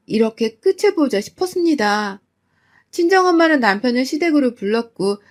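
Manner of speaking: ordinary delivery, nothing marked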